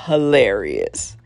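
normal talking voice

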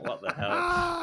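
very high, slow what the hell; hammed-up shocked squeal; mock horror. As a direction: drag it out in squeaky disgust after the bad kiss. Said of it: "she's just like".

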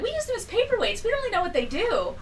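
office guy. Retold or imitated. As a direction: toss it off like a clerk who doesn't care. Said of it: "And the office guy's like".

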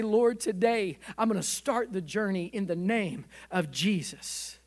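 loud, rolling altar prayer; fervent prayer. Acted. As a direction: pray over the whole room with force. Preacher leads the closing prayer.

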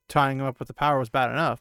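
usual speaking voice

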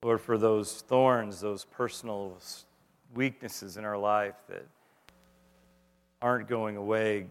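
slow, hushed pastoral prayer; closing prayer. Acted. Pastor leading the congregation in a closing prayer, hushed and slow. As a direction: pray it quietly, unhurried, over the room.

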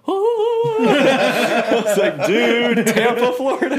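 very high, loud and laughing, slow; overjoyed laughing. Retold or imitated. Player reliving the USF email, squealing with laughing joy.

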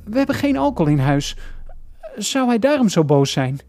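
high, frantic thinking aloud; panicked thinking. Acted. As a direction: grasp for each remembered rule, with hesitant stammers. Frantically recalling father's lessons. (paniekerig nadenkend)

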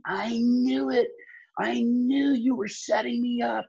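high, drawn-out mock scream; retold scream. Retelling a girl screaming at her friend, high mock scream. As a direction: stretch it out in a high mock yell. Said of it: "she started screaming".

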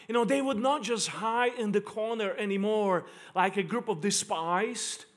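ordinary delivery

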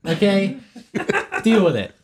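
high, loud and laughing; loud defiant taunt. Acted. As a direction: rub it in, loudly. Defiant retort in argument.

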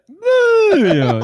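very high, loud falsetto squeal; drawn-out high mascot cry; Mario impression. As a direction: shriek it like a cartoon plumber. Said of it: "Yeah, just like that".

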